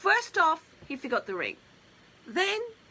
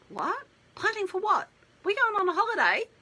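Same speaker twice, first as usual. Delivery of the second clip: high, louder baffled question; surprised; 10 semitones higher, 4 dB louder, faster than usual. Retold or imitated. Fiancée hearing about the wedding plans, high startled disbelief. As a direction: imitate a startled, confused fiancée.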